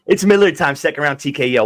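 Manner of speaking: high, loud hype call; excited shout. Real, not acted. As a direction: shout it like a fan. Hyping his pick.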